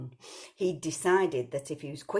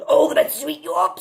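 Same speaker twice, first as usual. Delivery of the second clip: much louder, higher pounce; wolf roar; 10 semitones higher, 14 dB louder, faster than usual. Acted. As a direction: roar it as he leaps. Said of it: "said the big bad wolf".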